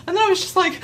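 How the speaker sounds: ordinary talk, nothing marked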